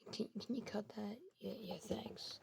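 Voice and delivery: hushed aside. Real, not acted. Narrator asks helper to cut that, hushed aside. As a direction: murmur it softly to a helper. Aside to someone about cutting.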